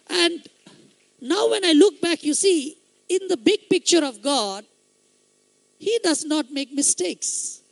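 loud, high-pitched preaching build; fervent pulpit climax; preaching at full force. Acted. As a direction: proclaim it to the whole hall.

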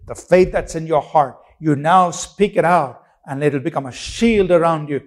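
usual speaking voice

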